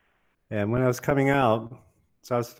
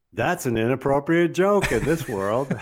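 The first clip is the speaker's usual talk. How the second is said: laughing; 5 semitones higher, 5 dB louder than usual. Real, not acted. Laughing at his own story.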